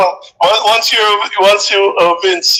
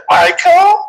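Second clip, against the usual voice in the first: high put-on foreign-sounding Michael; high put-on voice mocking her new accent; mimicking girl with fake accent; 13 semitones higher than usual. Imitating schoolmate returned with new accent, high put-on voice. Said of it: "she said".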